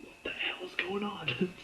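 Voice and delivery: slow whispered aside; hushed, bewildered, drawn out; imitated whisper. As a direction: Dodd whispering to himself once she leaves. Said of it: "he's like".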